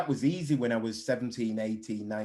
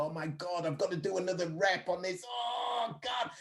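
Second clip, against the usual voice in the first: much higher, softer whine of self-pity; mock complaining; 7 semitones higher, 4 dB quieter than usual. Athlete moaning about another rep, hammed-up whine. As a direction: moan it like the rep is torture. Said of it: "allowed to complain".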